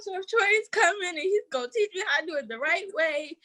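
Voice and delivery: put-on retelling childlike excitement; giddy quote to grandma; excited. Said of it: "I was like".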